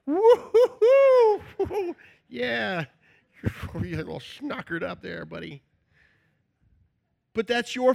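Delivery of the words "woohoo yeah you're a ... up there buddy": mocking relatives. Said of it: "They're like".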